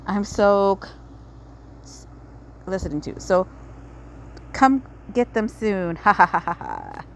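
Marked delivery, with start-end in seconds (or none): 6.0-7.0 villain laugh. Acted. Villain laughs after announcement.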